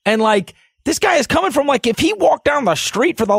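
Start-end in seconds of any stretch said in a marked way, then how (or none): none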